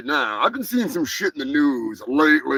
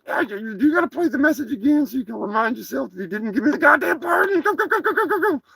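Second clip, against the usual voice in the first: brash turkey voice, pitched higher; a cocky cartoon turkey boasting; turkey character; 10 semitones higher, faster than usual. Brash turkey puppet talks back to the host, higher voice.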